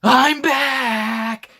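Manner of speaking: high, loud heroic yell; in character. Acted. Drunk reviewer acts out the movie's final heroic yell. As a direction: shout it like a pilot diving to his death. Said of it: "I was in character".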